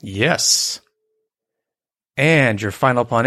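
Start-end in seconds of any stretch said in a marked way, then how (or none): none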